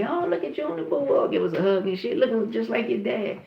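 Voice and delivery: high-pitched fussing greeting; gushing relative, retold. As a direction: lightly imitate a relative cooing over the kids.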